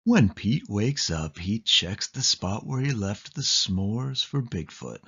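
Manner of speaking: plain reading voice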